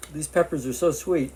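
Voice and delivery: talking while chewing; words round a mouthful of food; mouth full. Real, not acted. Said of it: "as I'm talking with my mouthful of food".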